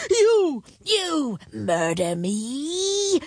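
high, slow incredulous dragon; flabbergasted dragon voice. A narrator's reading. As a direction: draw it out in disbelief.

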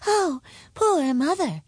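high, slow princess voice; high-pitched dismayed princess; pitying princess. A narrator's reading. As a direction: lament gently, drawn out and high.

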